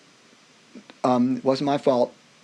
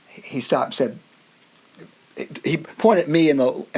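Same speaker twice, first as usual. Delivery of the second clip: ordinary delivery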